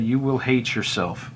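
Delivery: ordinary talk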